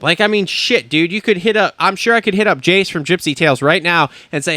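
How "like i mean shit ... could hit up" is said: normal talking voice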